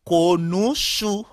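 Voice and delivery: konouchou drawn out syllable by syllable; slow teaching pronunciation of konouchou; slow demonstration. Shown as a demonstration. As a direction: say konouchou slowly, stretching it for learners. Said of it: "c'est ça que fait me dire".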